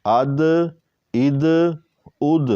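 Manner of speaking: "اد" with a firm echo; qalqala on dal. Shown as a demonstration. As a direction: bounce "اد" at the stop. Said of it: "یہاں بھی".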